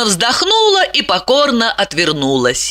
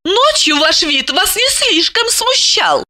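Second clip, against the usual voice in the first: high, biting woman's voice; venomous remark; 11 semitones higher than usual. A narrator's reading. Lipochka reproaches the half-dressed man, venomously. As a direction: needle him in a high voice. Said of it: "ядовито заметила Липочка".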